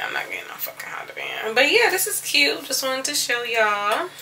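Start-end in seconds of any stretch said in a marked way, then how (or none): 0.1-1.5 quick whispered aside under her breath. Real, not acted. Vlogger whispers a joking aside to viewers, hushed and quick.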